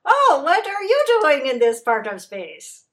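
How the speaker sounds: smiling ant pretending not to know a thing; feigned innocence. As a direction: play dumb, all sweetness. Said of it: "feigned innocence".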